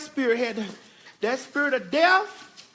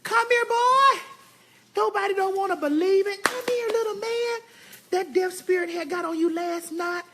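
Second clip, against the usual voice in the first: calling the dog; 9 semitones higher than usual. Real, not acted. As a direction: call him over, pitched way up. Calling the dogs over.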